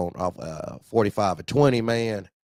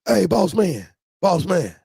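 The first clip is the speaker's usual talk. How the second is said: high pleading hey boss man, pitched way up; caller's voice; 10 semitones higher, 5 dB louder than usual. Retold or imitated. Driver calls the boss, high sweet-talking caller voice.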